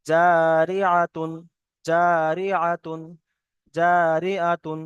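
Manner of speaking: জারিআতুন stretched slowly, ending clearly on -তুন; slow reading with tanween. Shown as a demonstration. Teacher reads the word as written, slow recitation. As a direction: draw out জারিআতুন syllable by syllable. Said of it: "এখানে লেখা আছে".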